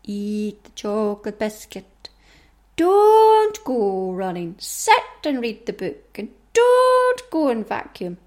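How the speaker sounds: slow, drawn-out coaxing with long "don't"s; wheedling inner-chimp voice; tempting inner chimp. Acted. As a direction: tempt them to laze about, stretching the words. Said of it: "the one that says".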